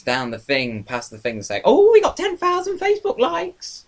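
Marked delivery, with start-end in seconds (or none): 1.6-3.8 very high, silly squeal; boastful put-on voice. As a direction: crow it in a comic high-pitched voice. Voicing a sign on the tower.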